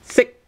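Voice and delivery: "Sick" high, cut off without releasing the k; high-pitched "Sick" with a swallowed final k; unreleased final k. Shown as a demonstration. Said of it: "without k, right?".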